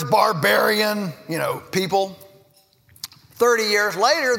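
ordinary delivery

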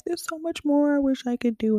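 high-pitched retold crying plea; imitated tearful Nana. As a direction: imitate a grandmother fretting through tears. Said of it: "she'd start crying because".

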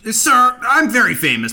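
high, loud celebrity protest; yelling. Pretend. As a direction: raise your voice to pull rank. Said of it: "I'm sorry I yelled".

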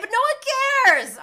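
loud, high burst of exasperation; exasperated outburst. Real, not acted. Host fed up with the glamping story, exasperated outburst. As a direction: cut down the boast at full volume.

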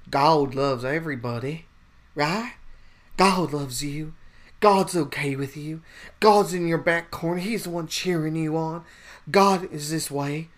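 mock the pastor cheering God on; Joel Osteen impression. Joel Osteen reassuring his flock, mocking preacher voice. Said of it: "he has that, like, accent".

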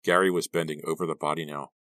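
plain reading voice

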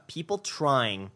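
usual speaking voice

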